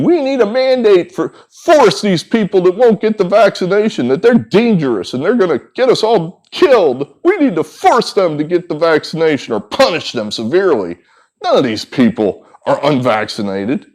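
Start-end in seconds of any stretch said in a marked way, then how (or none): 0.0-11.0 mocking panicky screamers. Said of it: "running around right now screaming".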